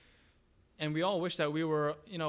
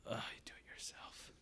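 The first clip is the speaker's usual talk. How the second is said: disgusted whispered grumble; very soft, voiceless aside; under the breath; 14 dB quieter than usual. Acted. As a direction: hiss the real answer in a whisper. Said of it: "then we whisper underneath our breath".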